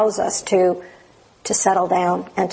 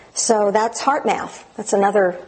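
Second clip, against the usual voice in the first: normal talking voice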